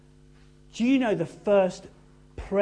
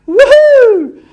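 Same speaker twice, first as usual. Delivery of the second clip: high, loud celebratory whoop; excited whoop; 20 dB louder than usual. Acted. Disciples cheering on return, a loud whoop. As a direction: cheer like you just won.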